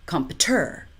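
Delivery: computer stressed on TER, compu-TER; stress on last syllable. Shown as a demonstration. Teacher showing wrong stress on the last syllable. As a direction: push the last beat of computer. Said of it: "or three".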